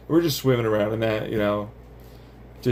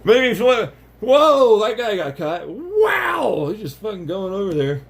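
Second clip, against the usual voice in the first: excited; 9 semitones higher, 4 dB louder than usual. Real, not acted. Excited watching particles.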